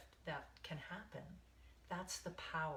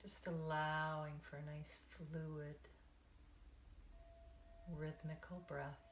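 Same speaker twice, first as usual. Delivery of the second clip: guided meditation. Acted. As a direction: ease them into the breath, one count at a time.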